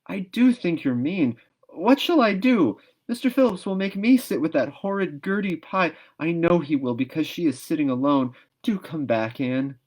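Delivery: put-on high, loud girlish pleading; Diana pleading near tears. A narrator's reading. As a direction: beg your friend, close to crying. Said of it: "looked as if she were ready to cry".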